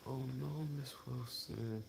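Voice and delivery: imitating the dentist. Dentist telling Miss Wilson her tooth is lost, in a soft high voice. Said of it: "She said".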